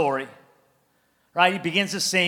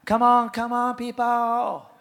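mock worship leader; 5 dB quieter than usual. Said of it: "He didn't have a worship leader say".

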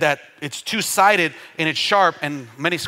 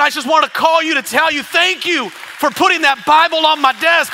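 high, loud outpouring of thanks; imitated crying caller; 12 semitones higher, 8 dB louder than usual. Broker thanking the preacher for the Bible, imitated impassioned cry. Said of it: "He was crying".

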